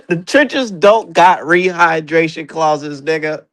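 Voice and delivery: slow, deliberate mocking punchline. Real, not acted.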